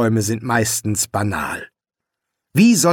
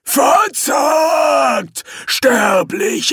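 threatening demon; 13 semitones higher, 3 dB louder, much slower than usual. Acted.

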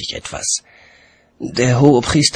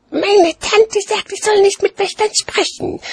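put-on child announcing; 4 dB louder than usual. A narrator's reading. Child telling watchman what aunt forbids, announcing in high voice. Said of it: "verkündete das Kind".

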